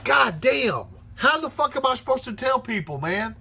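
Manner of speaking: high-pitched exasperated griping; exasperated outburst. Real, not acted. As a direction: vent in frustration about the missing links. Exasperated rant about missing links.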